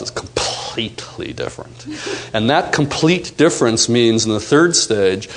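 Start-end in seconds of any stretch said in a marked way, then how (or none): 0.0-1.9 whispered aside. Real, not acted. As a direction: drop to a whisper. Soft end of point.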